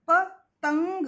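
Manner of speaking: पतंग said very slowly and loud, ंग held after ता; slow syllable demo. Shown as a demonstration. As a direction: show the nasal after ता. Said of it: "ता के बाद अंग का उच्चारण आया". (पतंग को बहुत धीरे, ता के बाद अंग खींचकर)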